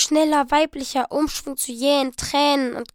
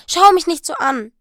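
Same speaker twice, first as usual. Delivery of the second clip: louder and higher, rushing anxious plea; pleading excitedly; 5 semitones higher, 8 dB louder than usual. Acted. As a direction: talk fast to win him over. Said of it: "rief sie". (aufgeregt flehend, laut und hoch)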